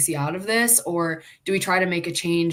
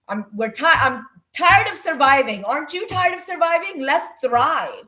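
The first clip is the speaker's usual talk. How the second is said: high, loud, fired-up pitch; rallying cry; 8 semitones higher, 5 dB louder than usual. Retold or imitated. Coach urges athletes to stop surviving, fired up. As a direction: rouse them to thrive. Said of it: "I'm always like".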